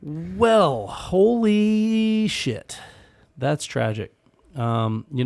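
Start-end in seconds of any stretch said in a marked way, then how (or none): 0.0-2.8 stunned drawl. Real, not acted. Reacting to tragic story.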